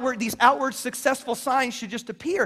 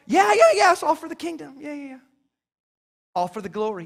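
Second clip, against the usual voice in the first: mock breezy self; 3 semitones higher than usual. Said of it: "and I just was like".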